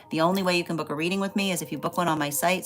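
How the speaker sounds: ordinary delivery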